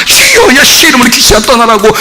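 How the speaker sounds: high, forceful mock deliverance cry; imitating a heated exorcism prayer; fervent prayer. Mimics fervent Christians praying against demons.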